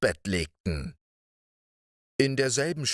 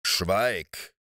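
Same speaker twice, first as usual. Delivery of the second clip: a gruff giant silencing his wife; angry giant. Acted. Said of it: "rief der Riese zornig".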